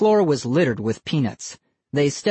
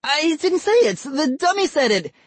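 exclaiming. A narrator's reading. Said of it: "Ian exclaimed".

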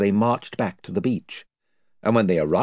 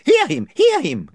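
higher, eager echoing crowd; chorus of voices; 7 dB louder than usual. Acted. Invisible followers backing their chief, higher and eager. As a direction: chime in like a fawning gang. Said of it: "came the chorus".

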